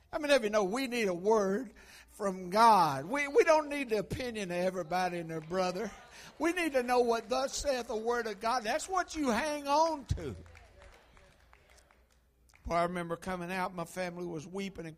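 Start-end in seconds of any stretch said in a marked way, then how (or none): 0.1-10.3 high, loud preaching build; fervent sermon climax. Acted. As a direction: drive it home to the congregation. Preacher rising to full force.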